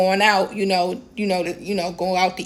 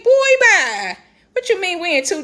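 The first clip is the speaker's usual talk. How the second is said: exasperated dismissal; 8 semitones higher than usual. Retold or imitated. Woman brushing off a man who mocked her money, scoffing. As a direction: wave him off with a scoff.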